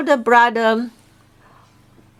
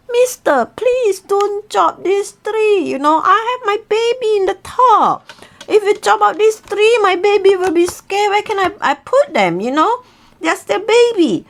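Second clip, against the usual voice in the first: pleading eagle; 10 semitones higher than usual. Acted. Eagle begs for babies.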